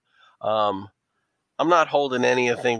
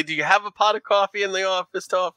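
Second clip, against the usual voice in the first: higher mock New York accent on "coffee"; 8 semitones higher, faster than usual. Player asks for office coffee in a New York accent. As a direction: lean in, New Yorker asking for coffee. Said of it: "do the New York accent with coffee".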